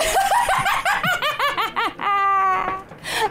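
high, drawn-out cackle; piercing crone cackle that keeps going; wicked crone cackle. Acted. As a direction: burst into a long villainous laugh. Crone told she is laughing.

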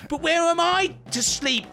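high, wailing mouse witch; crying out. A narrator's reading. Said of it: "cried the witch".

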